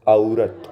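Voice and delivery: औरत said slowly as "a-oo-rat", both vowels sounded; pronunciation demo. Shown as a demonstration. Teacher showing students how the word is said, demonstrating औरत with a drawn-out au. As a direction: stretch the opening of औरत into a then oo. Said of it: "ऐसे बोलते हैं औरत".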